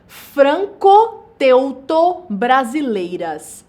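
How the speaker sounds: very slow, each part set apart; teacher's slow, emphatic model word; slow, segmented enunciation. Shown as a demonstration. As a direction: lay out the compound piece by piece. Teacher spells out compound part by part.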